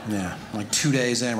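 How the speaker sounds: ordinary delivery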